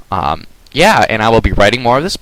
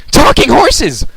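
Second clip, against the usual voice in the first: shocked cry; 6 dB louder, slower than usual. Acted. Creature shocked by ponies.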